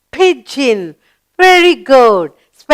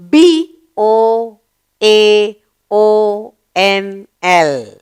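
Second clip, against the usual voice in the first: normal talking voice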